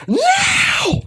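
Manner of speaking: very high, throat-straining battle cry; high, strained shout. A narrator's reading. Leader yelling the order to his army, high strained shout. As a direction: yell at the top of the lungs. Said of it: "shouts at a high voice".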